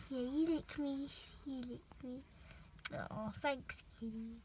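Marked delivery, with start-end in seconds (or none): none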